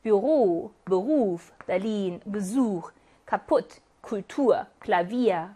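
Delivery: each word read slowly with the last syllable hit; second-syllable stress. Shown as a demonstration. Teacher reading a word list aloud for students, stressing the end. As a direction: model reading, leaning on the final syllable. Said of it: "이음절에 강세가 오는 경우 읽어보고 갈까요".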